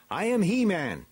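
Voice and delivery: high, ringing hero voice; heroic declaration. A narrator's reading. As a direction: proclaim your name to the court. Hero announces himself to elders.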